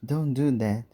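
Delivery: shout. Acted. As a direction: yell to stop her before the boat rocks. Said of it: "shouts Duncan".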